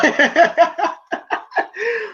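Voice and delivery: giggling high and bright; high laugh. Real, not acted. Laughs at robot scene.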